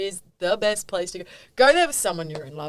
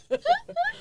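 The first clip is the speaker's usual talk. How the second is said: high laugh. Real, not acted. Laughing at her own joke.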